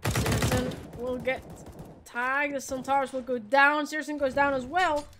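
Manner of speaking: brisk esports caster calling the round; live play-by-play. Acted. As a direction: call the action as it happens. Casting kills live.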